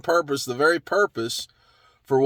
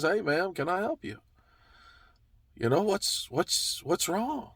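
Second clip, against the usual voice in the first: much softer, tender question to a weeping woman; gentle stranger; 2 semitones higher, 8 dB quieter than usual. Acted. Stranger gently asking crying Mary what's wrong, hushed and tender. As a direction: ask kindly, almost whispered.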